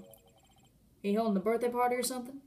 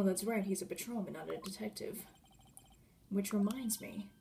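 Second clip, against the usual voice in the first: usual speaking voice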